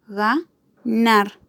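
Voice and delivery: "Ganar" broken into ga - nar, pausing between; syllable by syllable. Shown as a demonstration. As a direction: sound out "Ganar" in two separate syllables. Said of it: "Su acento está en la última sílaba". ("Ganar" dicho por sílabas, ga, nar)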